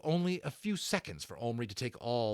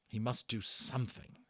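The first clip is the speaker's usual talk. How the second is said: ordinary delivery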